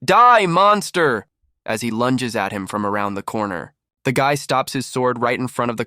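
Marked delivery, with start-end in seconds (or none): 0.0-1.3 loud, high, drawn-out battle cry; frightened shout. A narrator's reading. Frightened colleague lunging at him with a shout. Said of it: "frightened colleague in glasses who shouts".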